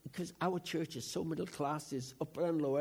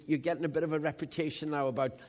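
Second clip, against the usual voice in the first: ordinary talk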